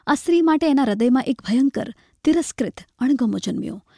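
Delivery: ordinary delivery, nothing marked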